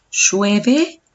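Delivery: "llueve" asked as a question, rising at the end; question intonation. Shown as a demonstration. Teacher showing students intonation, questioning "llueve". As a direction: ask "llueve" with a clear final rise. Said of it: "estoy diciendo una pregunta".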